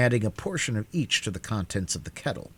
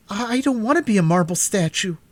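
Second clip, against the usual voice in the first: protesting; 10 semitones higher, 5 dB louder than usual. A narrator's reading. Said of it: "he protested".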